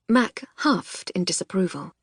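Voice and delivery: ordinary delivery, nothing marked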